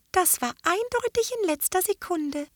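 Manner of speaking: very high, small squeaky voice; squeaky fairy voice. A narrator's reading. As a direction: chirp it like a tiny fairy. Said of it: "sagte eine piepsige Stimme".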